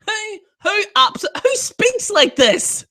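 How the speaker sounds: incredulous outburst. Real, not acted. Incredulous repeat of the question.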